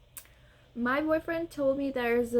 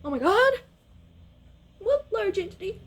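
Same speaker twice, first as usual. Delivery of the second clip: panicked cry; 8 semitones higher, 5 dB louder than usual. Retold or imitated. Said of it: "And I was like".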